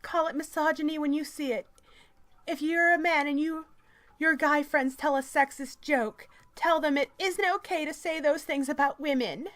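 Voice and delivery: high, loud mock-activist reading voice; strident article read. Reading a feminist article aloud to mock it, strident.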